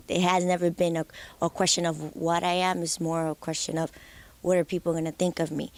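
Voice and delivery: ordinary talk, nothing marked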